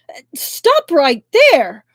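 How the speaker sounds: high, loud alarmed yelling; family yelling at intruders in shock and fury; shocked furious shout. A narrator's reading. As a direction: shout at the strangers fighting on the doorstep. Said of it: "They exclaimed in shock and fury".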